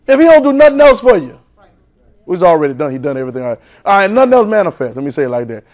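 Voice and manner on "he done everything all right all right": ordinary talk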